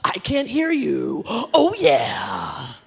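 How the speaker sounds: slow, loud mock wrestler taunt; wrestler bravado. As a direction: ham it up like a heel in the ring. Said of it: "And I was like".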